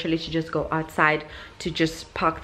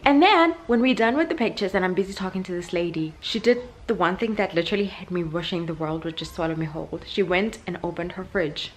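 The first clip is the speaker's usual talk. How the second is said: ordinary talk, nothing marked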